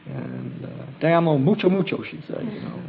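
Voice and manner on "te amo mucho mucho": fast, high, eager Spanish; rattled off quickly. Retold or imitated. Girl blurting her love to the group, fast and eager. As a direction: tumble the words out in a rush. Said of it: "very, very quickly".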